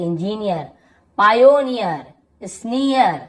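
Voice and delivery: इंजीनियर, पायोनियर, स्नीयर with stretched ee-yar; drawn-out ईयर ending. Shown as a demonstration. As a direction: sound out each word ending clearly. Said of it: "यहाँ पर भी देखिए ईयर ऐसे". (हर शब्द में ईयर साफ़ खींचकर)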